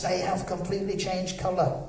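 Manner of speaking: usual speaking voice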